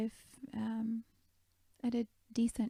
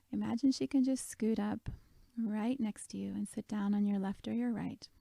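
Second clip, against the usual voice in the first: ordinary delivery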